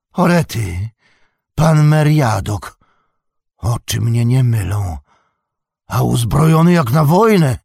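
put-on loud, astonished old hobbit; surprised shout. A narrator's reading. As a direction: cry out in amazement. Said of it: "krzyknął stary hop". (stary hobbit zdumiony, głośno krzyczy)

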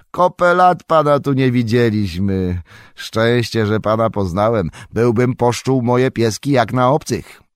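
a jovial farmer welcoming guests; hearty farmer. A narrator's reading. Farmer Maggot greets Pippin. (serdeczny głos farmera)

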